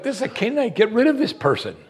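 high-pitched indignant complaint; acted disciples' protest. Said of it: "The disciples are like".

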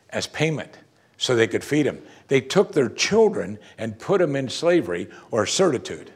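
normal talking voice

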